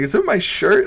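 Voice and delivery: high-pitched, bewildered appeal; mock-plaintive question. Comic retelling appeal to audience, mock-plaintive. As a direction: plead with the crowd, voice way up.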